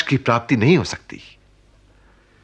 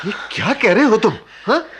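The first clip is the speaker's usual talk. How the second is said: shocked; 11 semitones higher, faster than usual. Acted. Uncle shocked by outburst.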